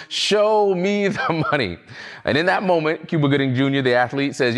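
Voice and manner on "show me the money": high, drawn-out mock yell, no real volume; mock scream. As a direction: stretch it out like an imitation of screaming. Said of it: "screaming at the top of his lungs".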